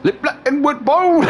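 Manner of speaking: very high, slow mimicry breaking into laughter; mimicking friend's delivery. Repeating a mate's joke to tease him, high laughing mimicry. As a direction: squeak out the practiced punchline, half laughing. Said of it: "it's the way you dropped it".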